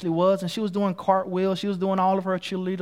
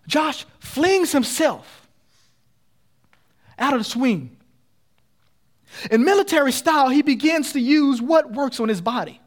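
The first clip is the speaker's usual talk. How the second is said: loud, driving, punched preacher storytelling; full-force preaching; 7 semitones higher, 5 dB louder than usual. Acted. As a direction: hammer each phrase like a dragging crawl. Preacher dramatizing the rescue.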